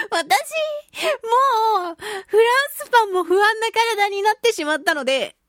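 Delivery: words cracking with laughter, dragged out; laughing while talking. Real, not acted. Laughing at herself over her fragile front teeth. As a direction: laugh through the line, barely holding it together.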